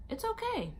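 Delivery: high, chirpy nurse reassurance; high sing-song nurse saying it's okay. Retold or imitated. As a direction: lilt it up like a cheerful nurse.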